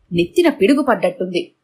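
ordinary delivery

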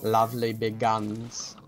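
slow, put-on British accent; mock-British, stretching each word. Shown as a demonstration. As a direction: show off a British accent, drawn out. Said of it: "You gotta say it with, like, a British accent".

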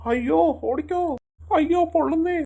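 villain wailing his tail is on fire; panicked cry. Acted. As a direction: yelp as your tail burns.

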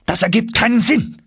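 shouting. A narrator's reading. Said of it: "schrie mein Onkel".

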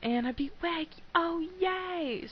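high cheering yay; celebrating. Real, not acted. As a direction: pitch jumps up with glee. Celebrating a win.

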